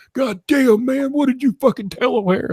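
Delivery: very high, panicked voice; hammed-up panic; panicked squeal. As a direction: freak out in a squeal. Tim panicking to Kenny.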